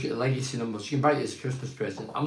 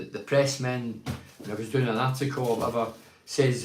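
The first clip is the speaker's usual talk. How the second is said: ordinary talk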